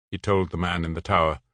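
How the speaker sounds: ordinary delivery, nothing marked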